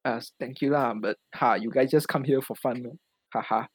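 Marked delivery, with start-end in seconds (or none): none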